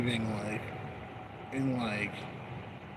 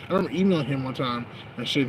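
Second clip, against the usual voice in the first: usual speaking voice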